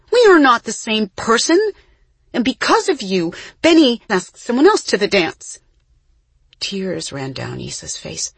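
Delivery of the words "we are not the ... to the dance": high, loud tirade; angry outburst. A narrator's reading. Isa blaming her twin for losing Benny, angry yelling. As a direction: keep yelling the accusation.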